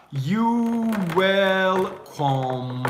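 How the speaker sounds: drawn-out "You welcome"; slow, sustained phrase. Shown as a demonstration. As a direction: stretch it way out. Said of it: "demo of delivery".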